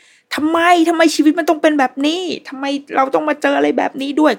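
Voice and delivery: high-pitched mock yell of despair; retold tearful shout. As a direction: imitate someone crying out at life, pitched well up. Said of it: "ร้องไห้ แล้วก็ตะโกนเสียงดังมากๆ".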